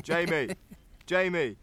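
reenacted call to a silent friend. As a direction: call his name across the room to wake him. Calling to unresponsive friend.

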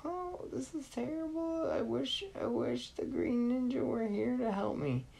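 put-on boy in despair, high and crying; whimpering boy. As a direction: wish for rescue near tears.

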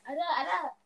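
high, loud insistent denial; loud laughing denial. Real, not acted.

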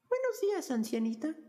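put-on very high, sweet young princess; cartoon princess. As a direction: greet her like a storybook princess. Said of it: "dijo la princesa".

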